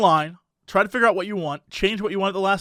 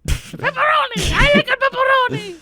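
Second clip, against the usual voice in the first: put-on goblin kid voice. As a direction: shriek it like a 2004 goblin. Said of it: "nerdy kid's voice is like a two thousand four goblin".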